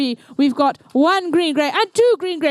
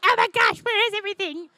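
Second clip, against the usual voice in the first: mock panic; 5 semitones higher, 4 dB quieter than usual. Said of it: "they were like".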